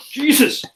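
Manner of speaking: sharp cough, then strained gasp; choking on water. Real, not acted. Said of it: "water went down the wrong hole".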